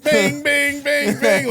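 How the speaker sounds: high, loud mock notification pings; ping it out like a phone going off; imitated text alerts. Mocking the early group texts with high pings. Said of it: "don't you love the group text".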